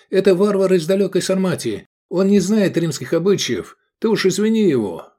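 high, loud, hasty appeasing; placating patter. A narrator's reading. Customer jumping up to placate cook, pattering. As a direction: babble to smooth it over. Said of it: "затараторил он".

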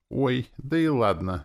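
high, drawn-out mock concession; snide. A narrator's reading. Demoness pretending to give in, snide. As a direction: concede with a catty lilt. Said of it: "ехидно подумала Настя".

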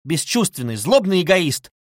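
indignant outburst; angry cry. A narrator's reading. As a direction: hurl the insult, building in force. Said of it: "вскрикнула Дуня".

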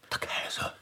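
awed whisper of der Kaiser. Acted. Bystander whispering the emperor's name in awe. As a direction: breathe it in disbelief.

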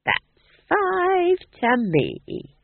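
high, very slow gloating glee; happily. Acted. Nanny Ogg gloating over her I spy score, high and slow. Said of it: "said Nanny happily".

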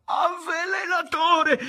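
high, drawn-out hateful yell; hateful shout. A narrator's reading. Revived man accusing his poisoner, hateful shout. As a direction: hurl the accusation loudly, stretched out. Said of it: "con odio ripeté la sua ultima".

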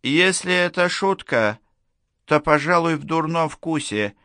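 put-on high, piping old man voice; squeaky old man. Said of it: "пискнул старичок в углу". (писклявый голос старичка)

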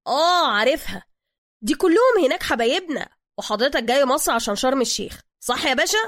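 pushy Cairo driver. Acted. Driver character voice.

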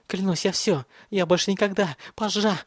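high, panicked, voice breaking; breaking into a cry. A narrator's reading. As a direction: beg until the voice cracks. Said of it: "Он сорвался на крик".

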